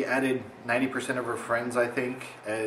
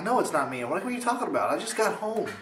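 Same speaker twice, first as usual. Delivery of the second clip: high innocent denial; feigned innocence; 8 semitones higher, faster than usual. Retold or imitated. Denying he made the gnome page, mock innocent and high. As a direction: play dumb, pitched up.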